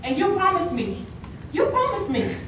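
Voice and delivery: acted reproach. Performer in a choreopoem recalling a promise, reproachful. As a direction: press the broken promise, pitch rising.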